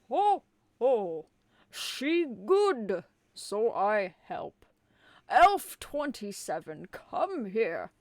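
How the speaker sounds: loud jolly ho-ho Santa; booming Santa. Acted. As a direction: boom it out like a big Santa. Santa speaks.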